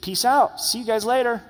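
high, casual "see ya" tossed off; breezy farewell. Retold or imitated. Preacher voicing Jesus leaving with a casual goodbye. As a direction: play Jesus as a chummy buddy waving goodbye. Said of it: "And then he's like".

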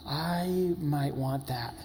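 very slow, soft, drawn-out comic confession; slow, tempted admission. Retold or imitated. Man eyeing another man's fanny pack, slow tempted admission. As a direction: stretch each word as if reluctantly tempted.

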